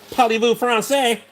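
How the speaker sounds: high, hammy French phrase; mock French accent. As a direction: toss it off in a fake French accent. Mocking French chat commenters.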